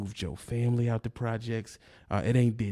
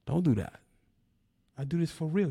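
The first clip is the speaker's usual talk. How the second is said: usual speaking voice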